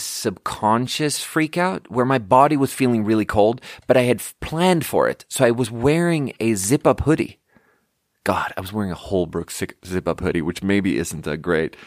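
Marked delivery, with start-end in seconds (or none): none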